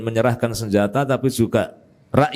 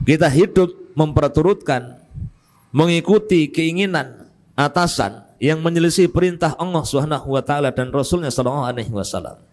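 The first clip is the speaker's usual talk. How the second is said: fervent sermon delivery, raised pitch; preaching; 7 semitones higher than usual. Acted. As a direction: preach it with rising conviction. Preaching with rising force.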